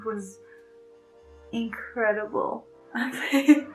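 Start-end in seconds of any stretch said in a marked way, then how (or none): none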